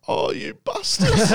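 high, slowed, laughing curse; laughing realization. Retold or imitated. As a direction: laugh it out as the penny drops. Mike realizing the prank.

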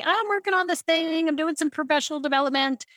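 high, quick, bright explaining; chirpy, upbeat announcement; cheerful explaining. Shown as a demonstration.

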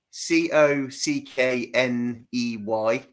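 "C O C K N E Y" slowly, letter by letter; spelling out. Shown as a demonstration. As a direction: spell "C O C K N E Y" out at dictation pace. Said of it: "how do you spell it".

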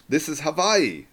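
"Hawaii" said slowly with a V. A narrator's reading. Local man settling the couple's argument, "Hawaii" with a V. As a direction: pronounce "Hawaii" as Ha-VAI-ee. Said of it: "how to pronounce the place".

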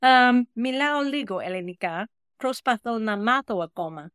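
careful learner's Greek; a few words of learner's Greek; speaking Greek. Shown as a demonstration. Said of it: "which means I speak a little Greek".

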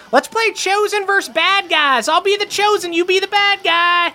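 put-on very high-pitched child voice; high little-kid make-believe voice; playing youngin. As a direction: call out like a kid starting a game. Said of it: "a few Crick elf youngins".

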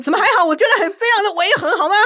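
high, fast, loud protest; how can you say that; indignant. Real, not acted. Objecting to friend's dismissal.

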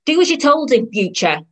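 high, loud telling-off; scolding. Acted. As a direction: lay down the law to a child. Mother scolds child, finger in face.

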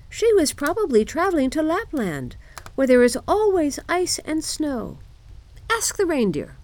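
high-pitched bird voice; reply high, like a little bird; wood pigeons. A narrator's reading. Pigeons answer Gerda about Lapland, high birdlike voice.

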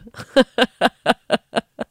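high, loud burst of laughter; big real laugh. Real, not acted. Host laughing at telling her inner voice off, loud laugh. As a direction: crack up at your own outburst. Cracks up at own outburst.

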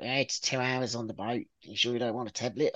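half-hearted Australian boat-crew impression; bad Australian accent. Boat crew offering her a seasickness tablet, in a bad Australian accent. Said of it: "I'm gonna do a really bad accent now".

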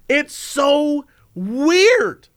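ordinary talk, nothing marked

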